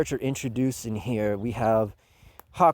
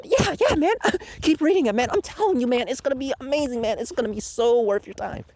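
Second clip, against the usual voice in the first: high-pitched eager fanboy voice; mock hyped fan; 11 semitones higher than usual. Fans urging the critic to keep reading, mock-eager. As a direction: oversell it like a devoted fan. Voicing fans hyping next arc.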